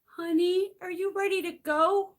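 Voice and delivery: high, sugary coaxing parent voice; mocking a doting father's sing-song; mock sweet dad. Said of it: "got down on his knees and goes".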